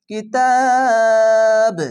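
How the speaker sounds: কিতাব stretched three alif then ba bounced; slow chanted কিতাব with qalqalah; qalqalah recitation. Shown as a demonstration. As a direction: recite কিতাব long, jolt the final ba. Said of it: "প্রথমে তিন আলিফ টেনে বাকে ধরে".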